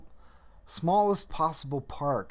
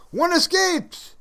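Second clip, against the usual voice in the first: gleeful high call; 8 dB louder, slower than usual. Real, not acted. Gleeful announcement after cheer.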